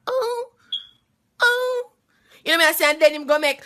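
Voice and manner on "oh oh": high, hammed-up fake moan; faking it the first time, mock moan. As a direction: moan falsely to please him. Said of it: "I just make a fake moan like".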